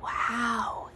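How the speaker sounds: hushed praise; a soft whispered wow; whispered delight. Real, not acted. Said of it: "your air was very soft".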